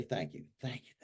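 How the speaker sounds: soft, hushed thank you to the money; whispered thanks. Shown as a demonstration. Grateful man softly thanking his money. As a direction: murmur gratitude under your breath.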